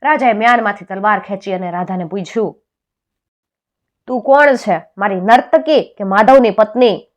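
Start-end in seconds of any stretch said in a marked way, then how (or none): 4.1-7.1 loud, high royal interrogation; angry demand. A narrator's reading. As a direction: demand an answer as an enraged king. Angry king questions Radha, sword drawn. (ગુસ્સામાં કડક સવાલ)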